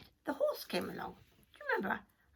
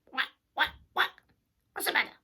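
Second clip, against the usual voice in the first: duck quack; 4 semitones higher, 5 dB louder, much faster than usual. Acted.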